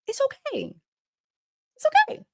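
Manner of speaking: very high, sing-song mock soothing; high sarcastic cooing; mock reassurance. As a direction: coo it sarcastically.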